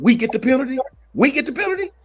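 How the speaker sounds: high-pitched indignant retelling; worked-up outrage. Retold or imitated. Host reliving the penalty call for the panel, high and outraged.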